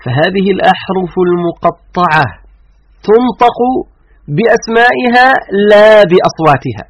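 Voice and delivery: ordinary talk, nothing marked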